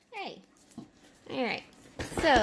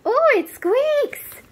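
surprised; 14 semitones higher, 6 dB louder, slower than usual. Real, not acted. Gasp at squeaky toy.

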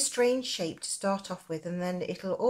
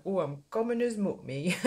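put-on Nottingham accent. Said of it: "my Nottingham accent coming through there".

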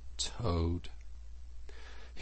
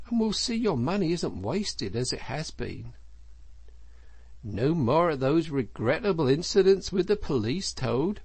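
higher, lilting, lightly voiced animal friends; coaxing friends; 7 semitones higher than usual. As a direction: gently chide while soothing.

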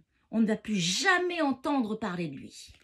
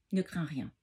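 determined; 7 semitones lower, 4 dB quieter than usual. A narrator's reading. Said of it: "lance Emma décidée".

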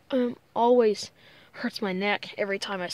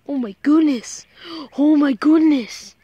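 gushing at puppy; 4 semitones higher, 9 dB louder than usual. Real, not acted. Vlogger fusses over a favorite puppy, gushing loudly. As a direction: fuss loudly over the dog.

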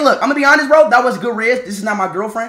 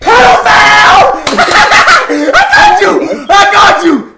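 laughing yell, much louder and higher; gleeful shout; 21 dB louder than usual. Real, not acted. Catches a creep, gleeful.

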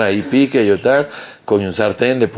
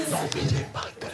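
whispered warning; 14 dB quieter than usual. Retold or imitated.

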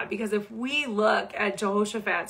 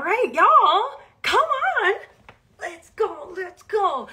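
high, drawn-out hype call; rally the crowd, let's go; hype cheer; 9 semitones higher, 4 dB louder, much slower than usual. Real, not acted. Rallying viewers to sign up.

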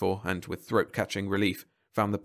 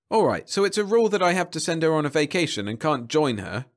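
loud, high angry grumbling; savage complaint; 7 semitones higher, 5 dB louder than usual. A narrator's reading. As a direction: complain bitterly about the rule. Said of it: "Jeb complained savagely".

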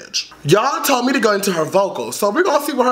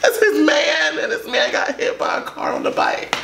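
tearful; 6 semitones higher than usual. Real, not acted. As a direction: voice breaking into a whisper. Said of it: "I'm about to cry".

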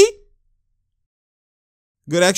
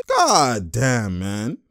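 usual speaking voice

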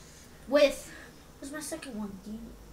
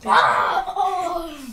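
pained laugh; 8 dB louder than usual. Real, not acted. Pained laugh from sour candy.

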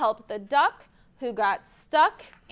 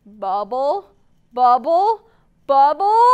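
growing bubbles; 8 dB louder than usual. Shown as a demonstration.

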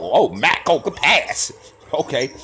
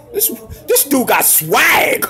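slow, drawn-out hyped shout; 10 semitones higher, slower than usual. Real, not acted. Praising the player's swagger, slow hyped shout. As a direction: stretch it out, impressed.

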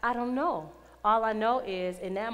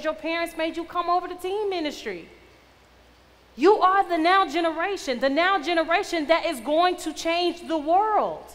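raised, louder exhortation; forceful preaching; 7 semitones higher, 8 dB louder than usual. Real, not acted. Charging a teen ministry to change the world, forceful preaching. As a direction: charge them up, hitting each key word.

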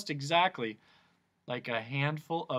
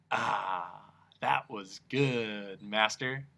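lower, slow smog creature; a lightly voiced big monster; lowered giant smog voice; Din's voice; 4 semitones lower, much slower than usual. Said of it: "he bellowed".

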